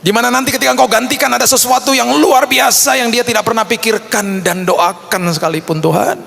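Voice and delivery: high, impassioned re-enacted prayer; fervent prayer. Father praying for the child's lost holiday, fervent retold prayer. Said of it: "kami berdoa dan saya katakan".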